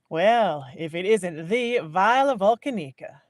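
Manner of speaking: loud, delighted greeting; loud welcome. Acted. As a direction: call out to an old friend. Reese greets Viola warmly.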